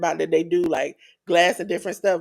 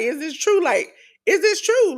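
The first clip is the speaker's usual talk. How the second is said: higher, rising choked-up disbelief; stunned disbelief; 8 semitones higher than usual. Retold or imitated. Punk learning he's in the main event, higher stunned disbelief. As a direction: ask it as if you can't believe the news. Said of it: "almost like he kinda said".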